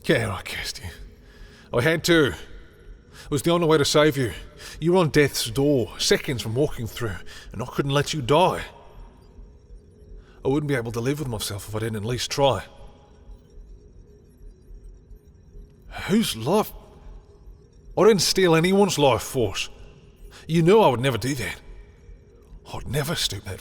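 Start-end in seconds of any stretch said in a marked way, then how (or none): 1.7-8.6 raised, higher voice defending the choice; desperate justification. Acted. Confessing under accusation, pleading defence. 16.1-21.6 loud, high-pitched outrage; indignant denial. Acted.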